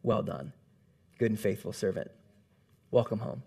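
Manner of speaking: low, hushed voice of God, not shouting; deep and soft like a blessing from the throne. Acted. God welcomes the believer home in a low, hushed voice. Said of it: "And God's voice shouts".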